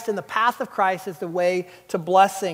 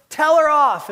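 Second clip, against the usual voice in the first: loud, higher push to scold her; urging; 6 semitones higher, 5 dB louder than usual. Retold or imitated. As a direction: egg the boss on. Urging boss to scold parent.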